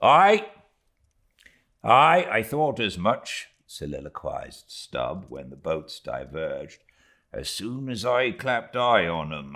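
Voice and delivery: lightly voiced easygoing harpooner-mate; a touch of jaunty boat-header; Stubb musing, then calling. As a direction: mutter it off, then rally the men. Stubb's soliloquy and orders.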